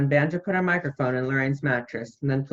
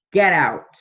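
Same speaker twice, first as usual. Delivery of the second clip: louder, snapping him out the door; loud dismissal; 6 dB louder than usual. Acted. Throwing the guest out.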